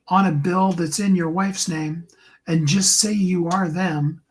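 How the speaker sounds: usual speaking voice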